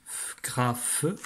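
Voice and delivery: "f, gra, feu" with the last sound detached; isolated final sound. Shown as a demonstration. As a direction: separate "feu" from "gra" to show the ending. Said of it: "On le retrouve à la fin". (« f, gra, feu », son final détaché)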